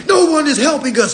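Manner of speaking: sarcastic imitation of complainers; mock whining. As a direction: mimic people crying that nobody helps them. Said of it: "folks be like".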